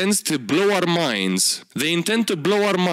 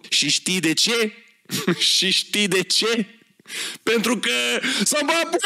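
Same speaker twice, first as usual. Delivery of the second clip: mocking punchline; 6 semitones higher than usual. Mocking a joke card punchline.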